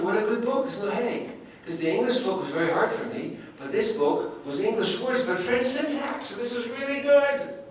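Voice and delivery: rising glee at an easy book; delighted recalled thought. Retold or imitated. Professor recalls his joy at the French-syntax book, climbing high. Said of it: "and I thought".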